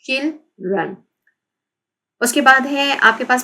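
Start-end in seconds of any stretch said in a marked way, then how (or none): 0.1-1.0 "children" split into two beats; syllable by syllable. Shown as a demonstration. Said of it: "टू syllable है".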